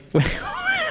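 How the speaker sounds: high burst of disbelieving laughter; incredulous laugh. Real, not acted. Mocking a fake story.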